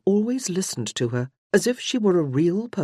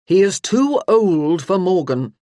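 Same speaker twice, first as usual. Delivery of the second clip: slow, louder, firm statement; 3 semitones higher, 7 dB louder than usual. Acted. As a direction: lay it down firmly and deliberately. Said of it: "Ranulph said firmly".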